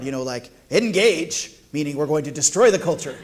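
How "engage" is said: a light Picard command, louder; Captain Picard. Retold or imitated. Pastor imitating Picard's command to his congregation, light mock order. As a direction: give the order like a starship captain. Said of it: "like Captain Picard".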